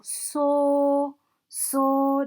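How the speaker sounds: "so" held like a sung note; first-tone "so", flat and stretched; steady high tone. Shown as a demonstration. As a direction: sing "so" long, level and high. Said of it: "sounds like somebody who's singing a steady high note".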